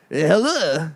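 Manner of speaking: put-on French accent. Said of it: "be that guy from France".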